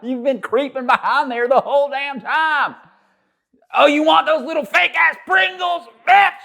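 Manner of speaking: high, loud playful yell, slowing at first; mock outraged shout. Real, not acted. To the friend creeping for Pringles, joking yell. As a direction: bust him for sneaking the snacks. Yelling at friend sneaking snacks.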